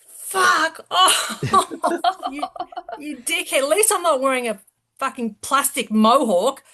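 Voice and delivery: an indignant high-pitched retold yell; retold angry outburst. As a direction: snap back at the obnoxious guy in the retelling. Retold snapping back at heckler.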